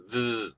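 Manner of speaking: "th-" held as a soft buzz, as in "they"; voiced buzzing TH. Shown as a demonstration. Teacher repeating the sound for learners, buzzing "th-". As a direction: sustain a vibrating "th-". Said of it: "it's, it's vibrational".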